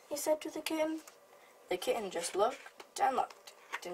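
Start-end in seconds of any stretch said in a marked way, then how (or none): none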